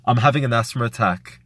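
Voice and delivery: ordinary talk, nothing marked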